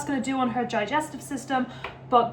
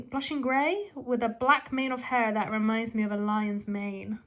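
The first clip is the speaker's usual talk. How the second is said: ordinary talk, nothing marked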